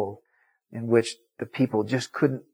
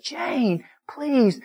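high, imploring appeal, acted out; pleading; 12 semitones higher than usual. Preacher begs angry Jane to listen, pleading. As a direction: beg her to listen, voice pitched high.

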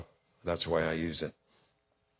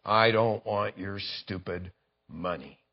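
snarl; 5 semitones lower, 5 dB quieter, slower than usual. Pretend. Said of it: "he snarls".

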